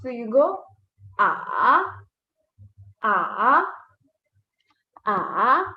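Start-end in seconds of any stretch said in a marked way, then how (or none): 1.2-1.8 AH dipping low, then rising back up; falling-rising AH; third tone. Shown as a demonstration. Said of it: "going down and then back up". 3.0-3.6 AH dipping low, then rising back up; falling-rising AH; third tone. Shown as a demonstration. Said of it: "going down and then back up". 5.1-5.6 AH dipping low, then rising back up; falling-rising AH; third tone. Shown as a demonstration. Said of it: "going down and then back up".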